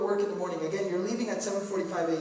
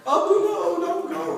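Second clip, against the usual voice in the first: high, hammed-up child's wail; mock crying and yelling; 8 semitones higher, 5 dB louder, slower than usual. Child pleading with departing father, theatrical high cry. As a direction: plead like an inconsolable little kid. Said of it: "They cry and they yell and they scream".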